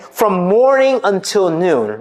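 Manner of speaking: normal talking voice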